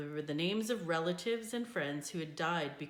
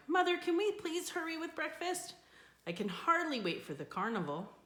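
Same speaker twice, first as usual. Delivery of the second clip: put-on high, eager plea from a little girl; rushing in, begging to hurry; excited girl; 11 semitones higher than usual. A narrator's reading. Girl begging mother for breakfast, excited and high. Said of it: "Sadako cried".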